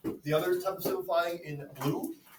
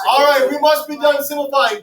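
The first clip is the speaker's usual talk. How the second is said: raised voice over the class; loud call to class; 8 semitones higher, 13 dB louder than usual. Real, not acted. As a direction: call the room back to attention. Teacher calls class to order.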